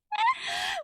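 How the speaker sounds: squeaky laugh through pain; pained giggle. Real, not acted. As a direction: laugh at your own mishap. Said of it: "I bit my tongue".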